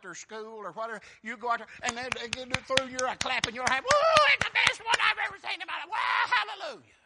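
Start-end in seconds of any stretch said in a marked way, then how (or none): 3.8-6.9 much higher, loud excited shout; shouting grandparent bragging on the grandkid; cheering grandparent. Retold or imitated. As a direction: yell like a proud grandparent in the stands. Proud grandparent cheering at game.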